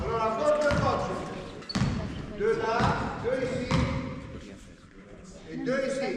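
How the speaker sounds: loud coach commands; loud directions. Real, not acted. Coach placing players around the court, loud commands. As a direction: bark out the positions.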